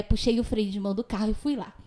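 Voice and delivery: normal talking voice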